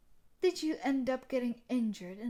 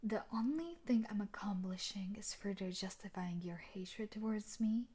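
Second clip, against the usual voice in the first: ordinary talk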